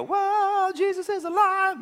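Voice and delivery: high, hammed-up gasp of joy; mock excited. Preacher mocking disciples who never cheered, excited. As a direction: play the overjoyed disciple for laughs. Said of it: "they didn't get excited and go".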